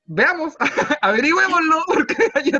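loud, high laughing challenge; laughing at the host's question; laughing dare. Real, not acted.

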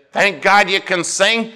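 high, loud indignant preaching; acted impassioned tirade. Preacher quoting his pastor on misplaced applause, impassioned. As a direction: build the offended pastor's case.

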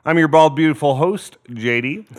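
slow, drawn-out welcome; show host intro. Acted. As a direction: announce the show grandly. Host opens the show slowly.

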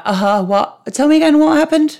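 high-pitched feigned confusion; mock cluelessness. Podcaster joking she'll forget the first shows, mock clueless. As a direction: play lost and baffled, rising high. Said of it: "I'm gonna be like".

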